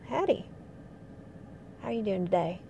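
friendly Southern US accent greeting. Shown as a demonstration. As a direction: put on a folksy Southern accent. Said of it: "slip into the Southern accent".

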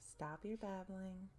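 ordinary delivery

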